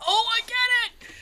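very high, stretched squeal of realisation; excited kid. Retold or imitated. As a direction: imitate an awestruck kid, voice way up. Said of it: "And he's like".

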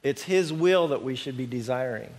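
usual speaking voice

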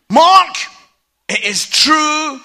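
high, loud mock telling-off; stern wife. Wife to husband after his show, imitated loud and stern. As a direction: bark the name, then stretch the admission.

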